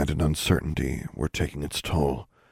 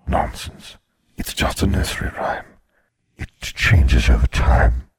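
grim reaper voice; 3 semitones higher, 3 dB louder than usual. A narrator's reading. Death speaks.

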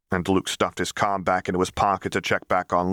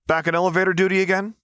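Luke's hushed friendly call-out, higher and quick; cheerful call; 11 semitones higher, faster than usual. Acted. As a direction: call out brightly but keep it down. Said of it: "he called out to the two".

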